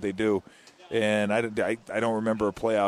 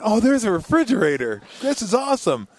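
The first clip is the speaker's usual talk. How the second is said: higher, louder happy surprise; discovering the hidden fridge with glee; delighted to find the fridge, much higher and louder; 9 semitones higher, 5 dB louder than usual. Retold or imitated. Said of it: "and I went".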